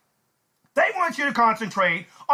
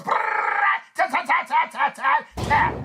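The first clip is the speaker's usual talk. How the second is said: rolled burst, then rapid staccato shots; rat-a-tat mouth gunfire; imitated gunfire; 7 semitones higher than usual. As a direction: mimic a machine gun with the mouth.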